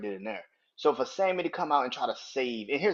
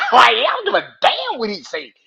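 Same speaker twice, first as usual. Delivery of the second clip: loud, higher defiant yell, laughing through it; defiant shout; 10 semitones higher, 14 dB louder than usual. Retold or imitated. Host re-shouting Jey's line, amused and loud. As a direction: hurl it out, half laughing. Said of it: "And Jay was like".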